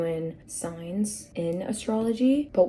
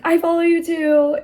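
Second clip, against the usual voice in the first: higher, louder eager chime-in; eager fan; 11 semitones higher, 11 dB louder than usual. Retold or imitated. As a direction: jump in eagerly like a thrilled fan. Second fan chiming in.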